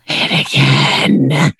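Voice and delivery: low, drawn-out mock-lustful demand; growing urgent on a slow, deep voice. Host begging to hear French voicemail, low playful craving.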